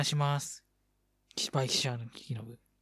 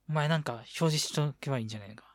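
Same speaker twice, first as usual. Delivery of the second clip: normal talking voice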